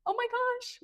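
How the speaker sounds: high startled exclamation; surprised gasp. Retold or imitated. Fan spotting her idol in the elevator, high surprise. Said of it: "And I was like".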